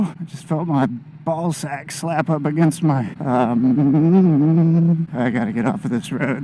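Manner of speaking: ordinary delivery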